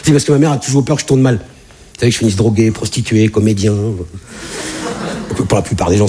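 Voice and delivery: normal talking voice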